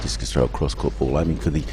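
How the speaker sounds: low, measured sports commentary; live play-by-play. Real, not acted. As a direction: call the throw as it happens, low and contained.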